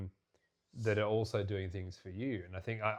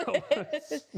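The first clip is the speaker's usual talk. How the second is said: laughing; 5 dB louder than usual. Real, not acted. Laughing at partner's joke.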